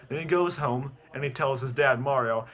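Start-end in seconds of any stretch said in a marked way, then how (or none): none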